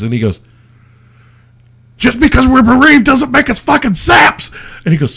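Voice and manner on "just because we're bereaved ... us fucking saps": high-pitched acted outrage; indignant outburst. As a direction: play a bereaved customer blowing up. Acts out angry mourner in film.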